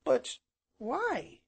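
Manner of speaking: put-on high puzzled child voice; small boy. A narrator's reading. Small boy questions uncle's answer, high and puzzled. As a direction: ask it high, like a little boy.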